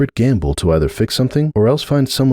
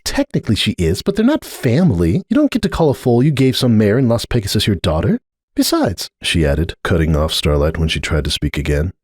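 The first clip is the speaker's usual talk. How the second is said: Cupcake, dismissive; 2 semitones higher than usual. Acted. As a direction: wave it away firmly.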